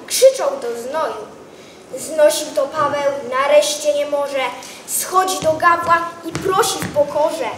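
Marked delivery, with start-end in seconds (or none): none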